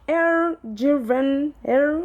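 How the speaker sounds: brand name Air Driven tried in an English accent, slowly; slow careful English-accented brand name; English accent attempt. Real, not acted. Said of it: "Ne vous moquez pas de mon accent anglais".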